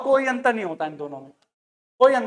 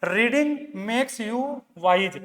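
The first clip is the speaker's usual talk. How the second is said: very slow, each word spelled out for dictation; slow teacher dictation; 3 dB quieter, much slower than usual. Shown as a demonstration. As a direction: read it out slowly so students can write. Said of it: "इसका उदाहरण बताता हूं मैं".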